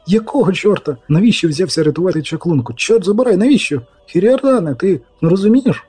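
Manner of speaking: high, exasperated questioning; dismayed lament. Acted. Dandelion laments Geralt's rescue attempt.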